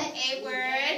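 loud, separate chunk for a class to echo; repeat-after-me modelling. Shown as a demonstration. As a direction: call it out for pupils to repeat. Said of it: "Everyone repeat after me".

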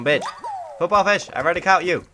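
loud cry of disgust; frustrated yell. Real, not acted. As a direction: bellow at the fish you already own. Catches repeat fish, yells.

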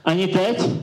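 high, put-on questioning voice; hopeful captive plea. Captive asks once more.

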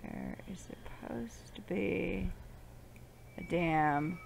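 slow, hushed seething; restrained exasperation. Real, not acted. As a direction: draw it out softly, holding back. Exasperated search for missing mirror.

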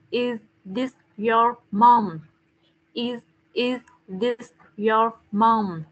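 slow, careful teacher demo of the question; slow model reading. Shown as a demonstration. Teacher slowly modelling the question for her pupil, slow and careful. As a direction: spell it out slowly for the child. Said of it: "con nói như này này".

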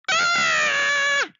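loud, high storybook scream; acted shriek of fright. A narrator's reading. Fisherman sees the shark's smile, loud high scream. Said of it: "screamed the fisherman".

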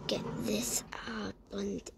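breathy whisper, slow. Real, not acted. Hiding from him, whispering to herself. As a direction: hush it so he won't hear.

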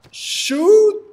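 high loud burst on shoot; sharp shouted shoot. Real, not acted. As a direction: yell it as you fire. Fires sniper.

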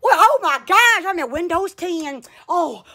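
ordinary talk

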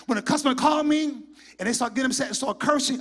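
ordinary talk

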